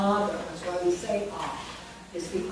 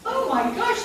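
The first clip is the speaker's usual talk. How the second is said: excited; 13 semitones higher than usual. Real, not acted. Teacher excited about sound rule.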